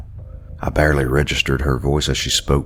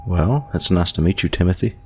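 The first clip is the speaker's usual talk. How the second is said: a light, kind adult tone; friendly officer; 5 semitones higher than usual. Acted. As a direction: say his name like a kind policewoman. Friendly officer addresses the boy.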